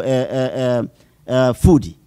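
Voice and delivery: normal talking voice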